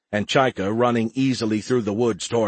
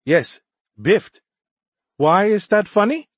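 high, louder earnest alien voice; puzzled young alien; 11 semitones higher, 6 dB louder than usual. A narrator's reading. Alien not getting the team's joke, puzzled high voice. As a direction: ask in innocent bewilderment. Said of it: "wondering if the gang were playing another prank".

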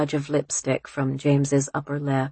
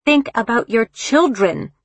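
raised, pressing, fearful appeal; desperate plea; 6 semitones higher, 4 dB louder than usual. A narrator's reading. Mother grabbing her daughter by both shoulders, desperate. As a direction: plead with urgency, pitched up. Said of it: "desperately. The look in her eyes was fear".

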